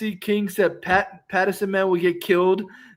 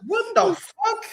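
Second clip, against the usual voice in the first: laughing disbelief; 13 semitones higher, much slower than usual. Real, not acted.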